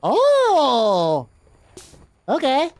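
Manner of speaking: ordinary delivery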